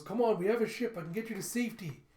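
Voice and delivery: high, loud, hurried urging; urgent plea. Acted. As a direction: urge them to flee, speeding up. Urging robot to escape.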